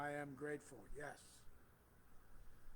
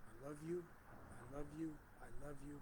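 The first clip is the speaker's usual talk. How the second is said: tender blessing; 7 dB quieter than usual. Real, not acted. Blessing the congregation softly.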